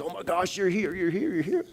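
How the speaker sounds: high, hushed overjoyed reunion cry; breathless disbelief of joy; tearful joyful cry. Retold or imitated. Pastor reliving reunion with late wife, joyful cry. Hugging dead wife, happy tears.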